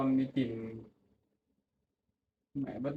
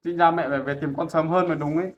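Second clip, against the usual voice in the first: raised voice, blaming; loud reproach; 9 dB louder than usual. Acted. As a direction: press the complaint loudly. Son reproaches mother.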